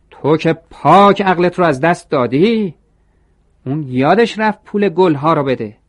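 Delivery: high, loud furious yell at the employee; angry shout. A narrator's reading. Shop manager yells at Toro for giving away flowers, furious shout. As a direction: scream it at him like he's lost his mind. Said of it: "سرش داد زد".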